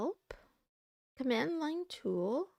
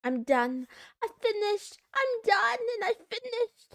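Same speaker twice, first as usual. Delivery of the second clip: loud, high, proud announcement of being done; triumphant shout; 9 semitones higher, 7 dB louder than usual. Real, not acted. Streamer shouting to chat that the work is done, triumphant. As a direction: belt it out like a victory cry. Announcing finishing coding task loudly.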